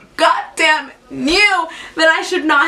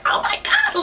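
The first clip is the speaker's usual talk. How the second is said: panicked squeal; 3 dB quieter than usual. Retold or imitated. Dad's hand on her shoulder.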